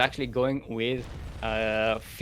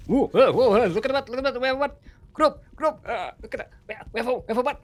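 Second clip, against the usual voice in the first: excited, high-pitched silly voice; mock-amazed squeaky chatter; high comic squawk; 11 semitones higher, 3 dB louder, much faster than usual. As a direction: squeal it in quick repeated bursts. Sudden comic excited squawking bit.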